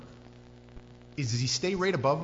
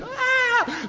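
loud, high-pitched acted scream; 9 dB louder than usual. Rowers think the boogeyman is coming, screaming. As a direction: shriek in terror at the figure. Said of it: "but they're screaming".

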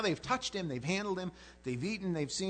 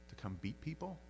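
ordinary talk

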